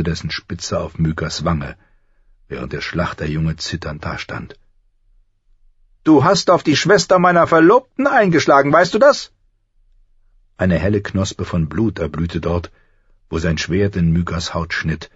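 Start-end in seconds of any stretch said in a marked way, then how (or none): 6.0-9.3 high, loud accusing prince; menacing accusation. A narrator's reading. Prince at swordpoint over struck sister, loud accusation. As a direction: accuse him loudly, pitched high.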